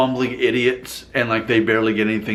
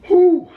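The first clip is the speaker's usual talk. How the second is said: amused whew; 8 dB louder than usual. Real, not acted. Exhale after laughing.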